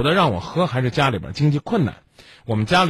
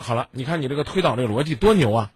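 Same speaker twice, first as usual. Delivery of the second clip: usual speaking voice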